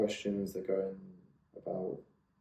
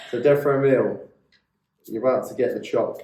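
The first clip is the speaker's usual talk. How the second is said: dramatic scene setting; 4 semitones higher, 12 dB louder than usual. Acted.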